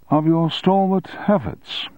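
ordinary delivery, nothing marked

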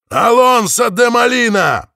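slow, loud, high proclamation; shouting. Acted. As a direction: shout your name proudly. Shouting own name back.

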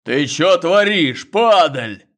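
fierce roaring shout; ferocious roar. A narrator's reading. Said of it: "взревел свирепый бас".

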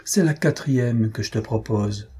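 usual speaking voice

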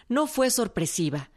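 ordinary talk

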